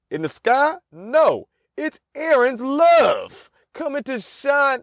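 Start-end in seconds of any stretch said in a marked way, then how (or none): 1.8-4.8 slow, high heroic announcement; mock-epic hero intro; grand reveal. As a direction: proclaim it like a superhero arriving. Superhero reveal, sung-out slow.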